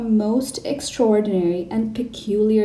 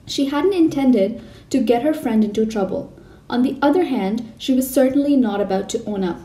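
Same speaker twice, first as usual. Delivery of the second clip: plain reading voice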